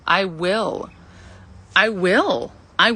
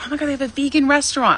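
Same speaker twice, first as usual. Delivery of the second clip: fast, high, thrilled exclamation; eager bubbly rush; excited; 7 semitones higher, faster than usual. Retold or imitated. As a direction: gush like a kid in a new city. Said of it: "I was just, like, excited".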